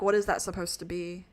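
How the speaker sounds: normal talking voice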